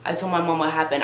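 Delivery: ordinary talk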